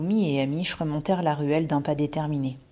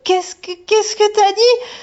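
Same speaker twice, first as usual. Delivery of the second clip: much higher and louder, slow and frightened; a shaky panicked question; worried stammer; 11 dB louder, slower than usual. Acted. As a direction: ask without daring to look.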